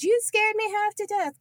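mock panicked mom, pitched high; high mock yell; mom screaming. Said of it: "My mom's screaming".

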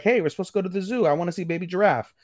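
high, quick imitation of eager kids; excited kid. Younger siblings asking to go to the zoo, excited. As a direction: play a child pestering for an outing.

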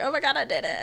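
excited fan voice, only lightly put on; mock triumph. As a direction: lightly cheer at finally covering it. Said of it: "I was like".

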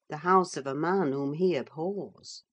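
plain reading voice

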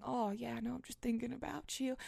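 soft, hushed flirty voice; mock seductive murmur. Mocking her younger self's sexting, soft and hushed. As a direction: purr it like a late-night sext.

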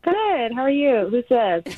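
bright-pitched, high friendly phone greeting. Real, not acted. Woman answering a radio show's call, friendly. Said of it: "So friendly".

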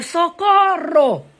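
long, high drawn-out call; cry for help. Acted. Psalmist cries upward to God for rescue, high drawn-out call. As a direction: stretch the word out high, crying for rescue. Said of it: "Yo voy a gritar".

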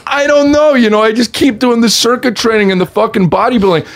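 high, loud defensive whine; hammed-up exasperated excuse; helpless protest. Acts himself protesting to agents.

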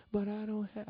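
high, protesting mock whine; whining excuse. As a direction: plead your excuse high. Acted excuses to the prophet.

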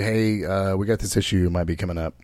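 low, soft, quick matter-of-fact heads-up; calm news report. Retold or imitated. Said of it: "just reporting the news like".

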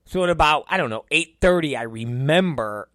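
ordinary talk

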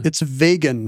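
"vegan" as VAY-gun, like Vega; joke pronunciation. Pretend. Joking it comes from the star Vega, "vegan" as VAY-gun. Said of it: "from the star Vega".

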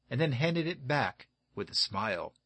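usual speaking voice

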